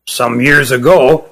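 slow, lightly voiced old elder. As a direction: ease into the old elder's voice slowly. Old Kirin Ruggum speaks.

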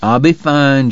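ordinary delivery, nothing marked

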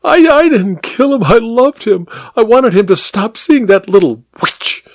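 high, breaking voice, real tears; crying. A narrator's reading. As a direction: sob it out, fading to a whisper. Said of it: "She began to cry".

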